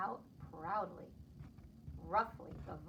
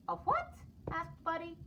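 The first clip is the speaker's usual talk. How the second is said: confused what; 9 semitones higher than usual. Acted. Buddy mishearing his father, very high and puzzled. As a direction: squeak it out, baffled.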